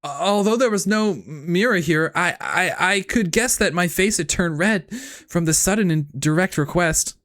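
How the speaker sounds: high anime hero narration, eager and earnest; Deku English impression. Streamer narrating the visual novel as Deku, high eager dub voice. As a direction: narrate like a nervous dub hero, pitched well up. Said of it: "all Deku".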